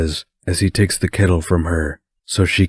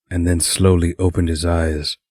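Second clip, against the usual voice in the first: ordinary delivery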